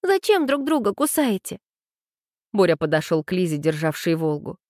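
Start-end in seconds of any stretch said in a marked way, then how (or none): 0.0-1.6 put-on high, cross girl's question; high-pitched indignation, mildly acted; angry. A narrator's reading. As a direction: demand an answer in a high young voice. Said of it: "разозлилась Аня".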